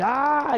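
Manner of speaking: effort shout. Acted. Swinging at creature.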